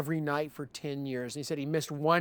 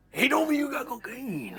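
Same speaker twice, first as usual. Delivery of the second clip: higher put-on local voice; big local guy; 7 semitones higher than usual. Big local guy confronting the musician, higher imitated voice. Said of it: "big old local guy".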